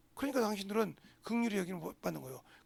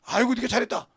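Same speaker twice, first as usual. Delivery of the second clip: sarcastic praise; 3 semitones higher, 8 dB louder than usual. Retold or imitated. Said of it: "이럽니까?".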